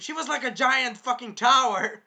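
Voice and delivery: loud, high-pitched amazement; amazed exclamation. Real, not acted. Fan describing the giant queen, loudly amazed.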